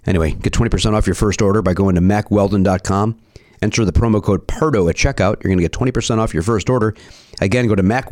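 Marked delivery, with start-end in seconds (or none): none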